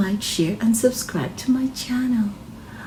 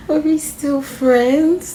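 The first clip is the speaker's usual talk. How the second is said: slow, higher, tentative ask; hopeful question; 7 semitones higher, slower than usual. Acted. Girl asking her friend if they are still friends, slow and hopeful.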